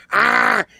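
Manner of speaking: loud, high "aah" before a bite; mock attack yell. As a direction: imitate a frenzied door-biter's yell. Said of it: "bites the bathroom door ... and goes".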